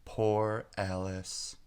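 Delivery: ordinary delivery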